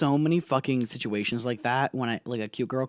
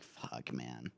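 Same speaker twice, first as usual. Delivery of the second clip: much softer, breathless whisper; whispered curse; 19 dB quieter than usual. Acted. Worn-out Frodo at Mount Doom, whispered. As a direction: breathe it out, drained.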